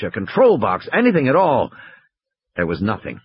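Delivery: ordinary delivery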